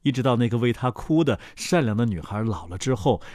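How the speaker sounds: ordinary delivery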